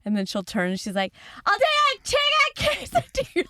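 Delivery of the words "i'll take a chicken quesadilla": very high, loud mock shriek; imitated yelling. Imitating best friend yelling at drive-thru speaker, shrieked order. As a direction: yell the order as if the speaker were broken. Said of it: "she yells everything".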